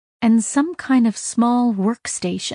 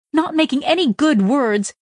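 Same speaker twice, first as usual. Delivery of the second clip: plain reading voice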